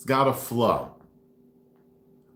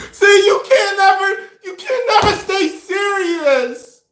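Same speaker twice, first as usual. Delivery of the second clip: high, loud whiny complaint; girlfriend voice; 7 dB louder, slower than usual. Retold or imitated. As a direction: shriek it like the girlfriend.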